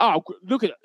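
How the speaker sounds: hammed-up Aussie bushman voice; Australian accent. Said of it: "you be Crocodile Dundee".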